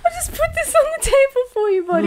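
high, laughing through the words; helpless giggly high voice. Real, not acted. Friend setting a drink down for struggling drinker, laughing.

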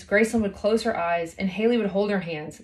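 ordinary delivery

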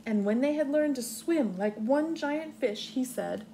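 slow, expressive storybook reading; reading to children. Acted. Teacher reading Swimmy aloud to her class, expressive storybook voice. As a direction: read it gently like a storybook. Reads picture book aloud.

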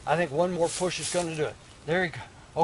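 normal talking voice

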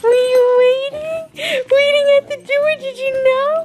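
very high, drawn-out squealed greeting. Real, not acted. Owner greeting puppy waiting at the door, squeaky baby talk. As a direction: coo loudly to a puppy in a very high voice. Greeting pets on arriving home.

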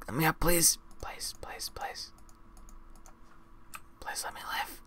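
very soft, breath-only begging; whispered plea. Real, not acted. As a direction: beg under your breath, in a whisper. Pleads while drowning in game.

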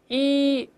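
一 drawn out high and thin, lips spread; long ee vowel. Shown as a demonstration. Teacher modeling the first vowel for students, long ee. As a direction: hold a long thin 一 sound. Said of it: "前面发一".

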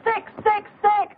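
high, hammered repeated sneer; spat disgust. Acted. Spits insult at suitor.

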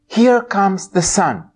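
plain reading voice

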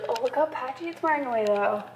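ordinary talk, nothing marked

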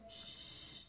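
soft unvoiced shush to a baby; breathy shh to quiet a little one; hushing whisper. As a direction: hush the baby gently. Mother hushing her baby.

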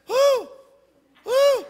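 short high-pitched woo; small hooting call, a novice trying to holler; timid holler "woo". Acted. City slicker tries hollering at longhorns, short high woos. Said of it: "and he went".

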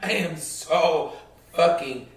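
low, slow, spaced-out words; real anger sinking toward a whisper; quietly pissed. Real, not acted. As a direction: seethe softly, pausing between words. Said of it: "I'm pissed".

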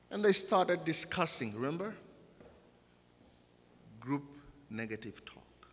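ordinary delivery

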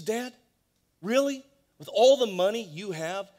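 an indignant son shouting at his father; outraged disbelief, voice thrown up high; angry. Retold or imitated. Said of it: "And he says angrily".

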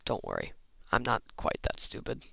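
ordinary talk

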